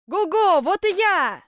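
big, high hallooing holler; exuberant shout. A narrator's reading. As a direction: shout it out to announce yourself. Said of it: "опять закричал Ганс Чурбан". (громкий задорный крик)

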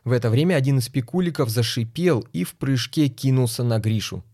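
plain reading voice

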